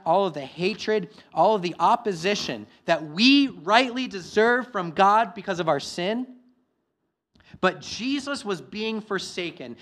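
loud, high, impassioned preaching; a pastor urging his church not to fear, loud and impassioned; preaching at full force. Acted. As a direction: proclaim it to the whole congregation. Preaching about the cross.

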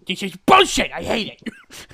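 loud angry complaint; frustrated shout. Real, not acted. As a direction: rant at the bad luck. Frustrated outburst.

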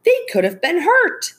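high, indignant outburst. A narrator's reading. Mom turning on her husband, upset. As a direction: scold him sharply, pitched high. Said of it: "raising her voice".

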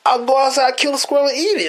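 high excited retort. Retold or imitated. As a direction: shoot it back, high and eager. Retold own defiant reply.

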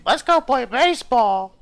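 put-on high, loud kid voice; eager little boys. Host imitating his ten-year-old friends, eager high kid voice. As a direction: imitate excited children in a squeaky shout.

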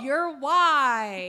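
loud, high reveal; dramatic reveal. Acted. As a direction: announce it like a drumroll. Drum roll announcement.

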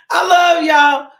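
loud, slow, half laughing half crying; loud emotional laugh-sob; laughing through tears. Real, not acted. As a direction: overcome with emotion, laugh-cry it out. Repeated farewell, overcome.

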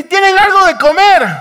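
high, loud shouted question; carrying, stretched-out hail; calling from shore. Retold or imitated. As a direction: holler it across the water. Man on shore calls out again.

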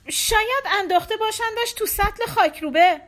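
grieving wife imagining the worst; bitter lament. A narrator's reading. Wife's bitter fear.

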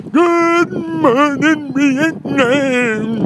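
drawn-out radio DJ shout; loud, slow DJ greeting; Robin Williams impression. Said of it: "Robin Williams ... where he is".

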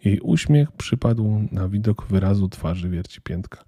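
ordinary delivery

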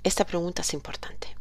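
quick whisper; whispered aside. Real, not acted. Teacher aside before the question.

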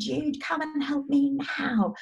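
put-on high, drawn-out childish wail; slow mock-tantrum demand in a child's voice; imitated child tantrum. Mother imitating daughter on the floor demanding help, whiny.

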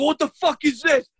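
high, exasperated retold outburst; losing it. Dad going through the subjects, losing it. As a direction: re-enact a dad at his wits' end. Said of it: "losing my mind".

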